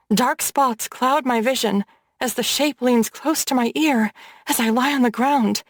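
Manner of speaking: ordinary delivery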